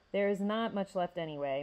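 usual speaking voice

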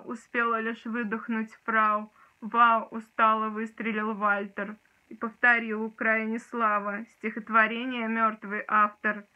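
reciting a poem with dramatic force; dramatic poetry declamation. Shown as a demonstration. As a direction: declaim it like on stage. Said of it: "сейчас тоже его прочитаю".

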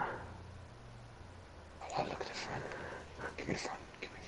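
very soft, voiceless echo; hushed playful mimicry; mock whisper. Echoes the other player in a whisper.

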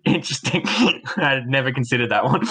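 high-pitched talk through laughter; amused laughter. Real, not acted. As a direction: giggle through the words, genuinely tickled. Amused by the co-host's remark.

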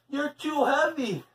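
high, effortful complaint; groaning. A narrator's reading. Leslie can't lift Paul, groaning with effort. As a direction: groan under his weight. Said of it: "she groaned".

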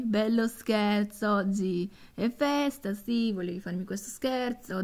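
ordinary delivery

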